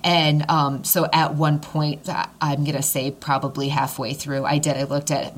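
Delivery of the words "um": usual speaking voice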